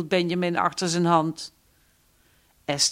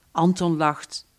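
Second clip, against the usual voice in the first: usual speaking voice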